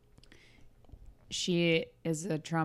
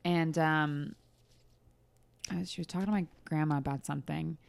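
ordinary talk, nothing marked

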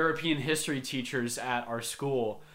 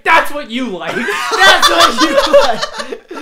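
high, loud taunt breaking into laughter; teacher taunting the student, laughing; 12 semitones higher, 15 dB louder, slower than usual. Retold or imitated. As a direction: crack up while mocking. Teacher taunting while jumping.

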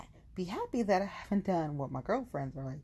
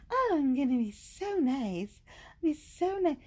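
high, loud, drawn-out fake-nice voice; mock sweetness; 10 semitones higher, 6 dB louder than usual. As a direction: stretch it out high, playing nice. Mocking herself forced to be nice.

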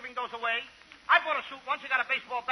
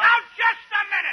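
loud protest; 7 semitones higher, 8 dB louder than usual. Acted. Costello protests loudly.

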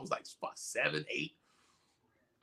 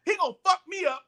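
high-pitched worried outburst; mock panic; 10 semitones higher than usual. Kid fearing his brother's anger, high mock panic. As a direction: replay the dread, pitched way up.

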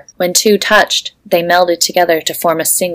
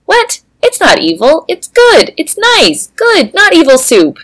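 high, loud, squeaky protest; indignant Willow; 11 semitones higher, 4 dB louder than usual. Acted. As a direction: defend the soup in a squeal. Willow defends her soup.

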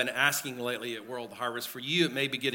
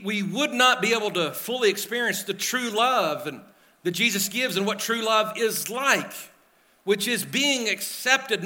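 loud, high, impassioned preaching; preaching at full force; 7 semitones higher, 6 dB louder than usual. Acted. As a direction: build the sermon to a passionate peak.

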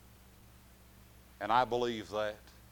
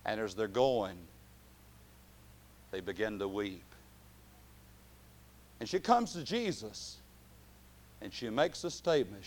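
ordinary talk, nothing marked

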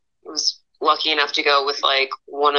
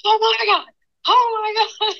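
high-pitched retold panic, oh my God twice; much higher frantic oh my God; panicked yelling. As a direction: shriek it as the pike surfaces. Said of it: "I just started yelling".

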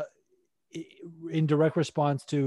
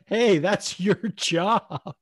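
drawn-out, higher, mildly loud mock call-out; retold call-out; 5 semitones higher, 3 dB louder, much slower than usual. Paul Feather calling out to a fighter, mock call-out. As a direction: call it out slowly like a heckler.

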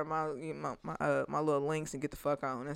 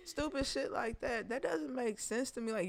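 usual speaking voice

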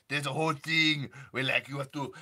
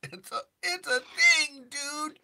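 high strained stoner voice; cartoon stoner voice, just shy of cracking; 4 dB louder, slower than usual. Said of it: "just above crack".

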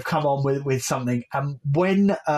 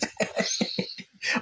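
breathy laugh; 8 dB quieter than usual. Real, not acted. Laughing at own joke.

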